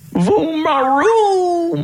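high, slow trumpet blast through the trunk; long high elephant trumpet; trumpeting elephant call. Acted. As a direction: trumpet it out like an elephant greeting the dawn.

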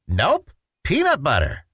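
lightly voiced stubborn boy; a kid's catchphrase, half sung; a hint of the little boy refusing; stubborn Petey. Petey's refrain, child voice.

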